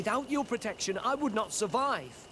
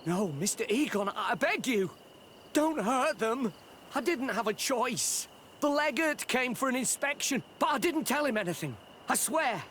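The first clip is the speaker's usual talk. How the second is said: higher-pitched frantic begging; desperate pleading; 6 semitones higher than usual. Acted. Father begs the gang boss to spare his children, desperate. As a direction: beg for your children's lives.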